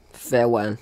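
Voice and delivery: usual speaking voice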